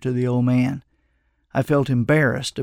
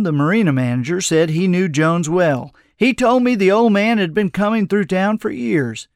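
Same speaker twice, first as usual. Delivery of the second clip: plain reading voice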